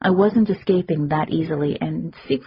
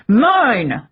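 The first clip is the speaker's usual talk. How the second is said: furious scream, higher and louder; 6 semitones higher, 6 dB louder than usual. Acted. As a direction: scream the claim at his sister. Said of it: "he screamed".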